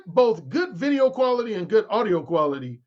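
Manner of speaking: ordinary talk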